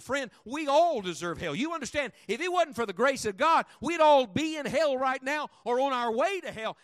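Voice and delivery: rising loud preaching, each "God" punched; preacher swelling into a loud climax. Acted. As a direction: build it up like a revival sermon.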